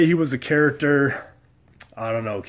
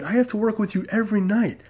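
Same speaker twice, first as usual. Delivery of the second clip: high exasperated plea; 9 semitones higher than usual. Retold or imitated. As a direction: voice jumps up high, pleading in disbelief. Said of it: "I said".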